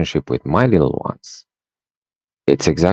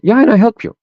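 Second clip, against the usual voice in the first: put-on high, louder child protest; insistent little girl; 5 dB louder, faster than usual. Daughter insisting to dad she helped, high loud child voice. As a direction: push back like an indignant toddler.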